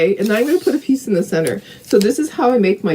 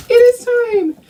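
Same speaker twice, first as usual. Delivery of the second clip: high, loud dramatic declare; mock dramatic; 4 dB louder than usual. Real, not acted. Announcing cleaning is due, mock dramatic. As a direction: proclaim it grandly. Emphatic repeat of It's time.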